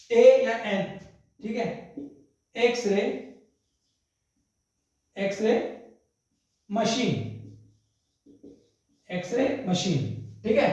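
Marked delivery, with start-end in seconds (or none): 2.5-10.4 एक्सरे said as eks-ray, starting with a vowel; pronunciation of x-ray. Shown as a demonstration. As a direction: begin एक्सरे with a clear eks sound. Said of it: "I have ए या एन एक्सरे machine". (एक्सरे का उच्चारण एक्स से, स्वर से शुरू)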